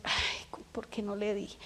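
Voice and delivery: breathy whispered regret; whispered self-reproach; regretful whisper. Retold or imitated. Retold regret after staying silent.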